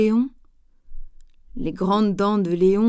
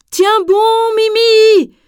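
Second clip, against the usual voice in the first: much higher, loud urgent shout; 12 semitones higher, 9 dB louder, slower than usual. A narrator's reading. Lion shouting to his friend while running, urgent. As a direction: yell across to her while running. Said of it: "hurle-t-il".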